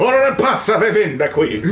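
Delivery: high, loud, hammed-up Italian accent. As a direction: belt out mock Italian like a caricature. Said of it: "could you do an Italian accent".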